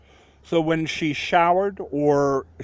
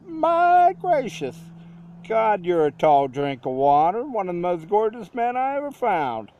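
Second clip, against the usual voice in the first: southern drawl; 7 semitones higher than usual. Retold or imitated. Woman admiring tall stranger, Southern accent. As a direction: gush over him in a Southern drawl. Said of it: "she said this in this southern kind of ... drawl".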